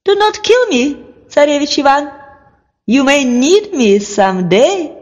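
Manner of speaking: high, pleading bird voice; a little drake begging for its life. Acted. Drake pleads with Tsarevich Ivan, high and pleading. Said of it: "the drake said to him in a human voice".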